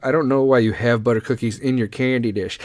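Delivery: ordinary talk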